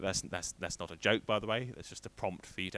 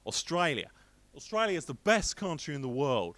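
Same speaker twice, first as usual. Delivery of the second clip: higher-pitched mock Aussie friend; bad Australian accent; 5 semitones higher than usual. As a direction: put on a clumsy Australian accent, proudly boasting. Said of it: "I can't do accents".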